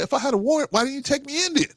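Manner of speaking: mock indignant why-not; indignant challenge. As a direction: pitch it up in disbelief. Retold challenge to the cop.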